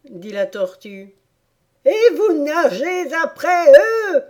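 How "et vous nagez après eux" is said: high, loud frenzied howl; crazed yell. Acted. Griffon carried away by the dance, howling wildly. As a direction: yell it like a griffon gone mad. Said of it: "hurla le griffon qui semblait être devenu fou".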